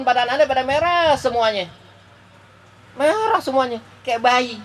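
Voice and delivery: put-on strained, high-pitched lament of an old prophet; Prophet Musa in pain. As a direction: lift the voice high as if still aching from death. Musa describes pain of death.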